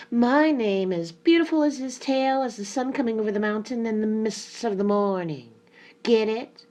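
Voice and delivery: high, loud, vain peacock; screeching peacock. A narrator's reading. Peacock proclaims his name to the hen, a high screech. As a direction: screech it out proudly. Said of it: "and screeched".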